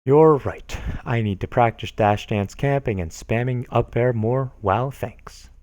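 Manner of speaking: ordinary talk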